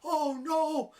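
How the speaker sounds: lightly acted old man's dismay; mock alarm. Reviewer voicing the blind man realizing the box floods, mock alarm.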